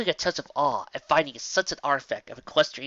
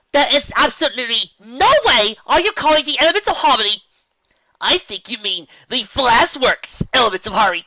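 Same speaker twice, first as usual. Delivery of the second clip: much higher and much louder, furious; angry unicorn; 9 semitones higher, 10 dB louder than usual. Acted. Irritated unicorn scolding Rainbow Dash, much louder and higher. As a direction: let her fury boil over at the twit.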